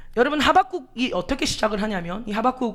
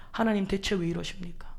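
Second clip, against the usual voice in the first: whispered, hushed plea to God; whispered complaint; 2 semitones lower, 7 dB quieter than usual. Retold or imitated. Believer grumbling to God under the breath, whispered. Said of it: "우리 그렇게 하나님 앞에 반문합니다".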